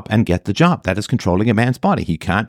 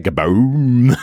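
comic boom; 2 semitones higher than usual. Pretend. Comic sound of sudden size.

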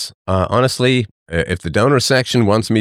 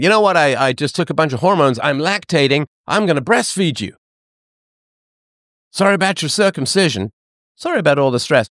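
high, gushing mother apologising; mock mom voice; 5 semitones higher than usual. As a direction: play the overly sorry mother, pitched up. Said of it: "Your mom coming up to you when you're thirty and saying".

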